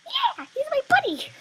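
very high, very loud squeal of joy; excited shriek. Real, not acted. Cheering as the trader accepts her offer, high shriek. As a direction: scream it like you just won.

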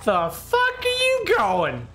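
high, drawn-out indignant yell; incredulous, stretched-out high shout; outraged yell. Real, not acted. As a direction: yell after someone getting away. Yells at a fleeing player.

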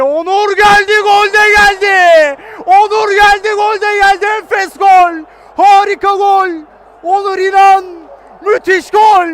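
goal scream at full voice; screaming football commentator. Real, not acted. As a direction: yell the goal call. Goal call, commentator erupts.

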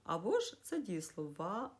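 normal talking voice

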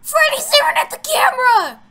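loud, high cry of fright; panicked shout. Real, not acted. Player spots Freddy on camera, panicked shout. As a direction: shout the alarm in shock.

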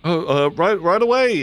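high, hurried yes; scrambling to obey; nervous crew kid. Acted. Kid obeys captain's order.

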